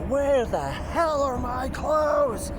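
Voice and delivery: slow, high, loud imitated outburst; patient's angry demand. Nurse imitates the angry patient demanding her clothes, slow and loud. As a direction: stretch out each word like an outraged patient. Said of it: "she's like".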